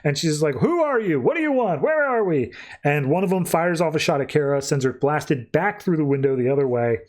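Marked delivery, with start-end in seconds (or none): 0.6-2.5 high-pitched retold demands; each question swooping high, mock alarm; alarmed demanding. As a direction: fire off acted, alarmed questions. Captive confronts her captors.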